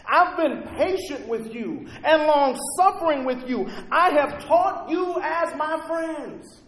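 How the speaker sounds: high, loud fervent preaching voice; preaching at full force. Acted. Pastor voicing Jesus to his church, high and fervent. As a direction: pour it out like a revival sermon.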